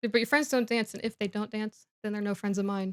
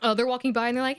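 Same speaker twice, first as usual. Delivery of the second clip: normal talking voice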